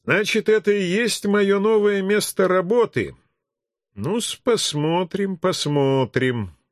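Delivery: musing to yourself with a touch of cheer; cheerful muttering to oneself. A narrator's reading. New arrival sizing up his workplace, mildly cheerful muttering to himself. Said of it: "бодро пробурчал я себе под нос".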